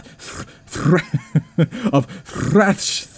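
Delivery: stumbling on the word, giggling; laughing through word. Real, not acted. Narrator cracking up over a tongue-twister, laughing. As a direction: laugh while fumbling the word.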